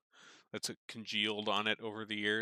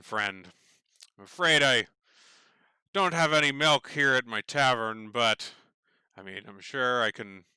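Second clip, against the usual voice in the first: loud, higher hearty tavern keeper; booming barkeep; 3 semitones higher, 9 dB louder than usual. Acted. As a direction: bellow it out like a jovial barman. Barkeep booms after belly laugh.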